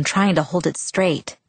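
ordinary delivery, nothing marked

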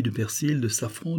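usual speaking voice

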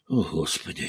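a quiet, defeated murmur; barely audible murmur. A narrator's reading. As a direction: breathe it out under his breath. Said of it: "чуть слышно пробормотал он".

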